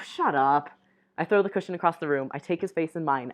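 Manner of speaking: ordinary delivery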